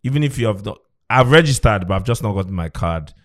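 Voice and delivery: usual speaking voice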